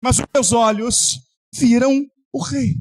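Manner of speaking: high, driving sermon climax; impassioned preaching. Acted. Pastor crying out to the church at full force. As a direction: preach it out to the congregation.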